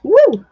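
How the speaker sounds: high loud whoop; excited whoop. Real, not acted. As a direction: let out a happy woo. Cheers while drawing.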